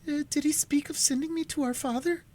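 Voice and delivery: put-on high, frightened young sister's plea; trembling young voice. A narrator's reading. Alice begging her sister Cora for an answer, high and frightened. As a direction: ask it high and fearful like a scared girl.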